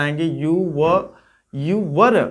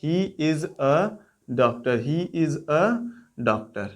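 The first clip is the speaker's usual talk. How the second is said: slow dictation; much slower than usual. Shown as a demonstration. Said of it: "teacher models English sentence slowly".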